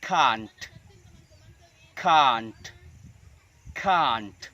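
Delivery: can't as drawn-out "kahnt", T released; British pronunciation. Shown as a demonstration. Teacher repeating three times, British long-vowel can't. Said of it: "dalam versi British".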